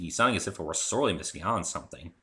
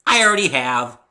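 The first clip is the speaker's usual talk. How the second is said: cheery; 8 semitones higher, 14 dB louder than usual. Acted. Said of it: "she said cheerily".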